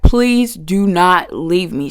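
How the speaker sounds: drawn out, begging slowly; slow desperate plea; slow pleading. Retold or imitated. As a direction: beg her not to hang up, slow. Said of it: "and I'm like".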